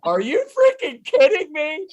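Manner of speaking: high, loud disbelieving yell; a mechanic yelling in amazement; astonished shout. Retold or imitated. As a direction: blurt it out in shock, shouting high. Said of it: "And he's like".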